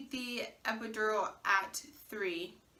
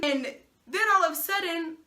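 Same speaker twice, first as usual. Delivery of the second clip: normal talking voice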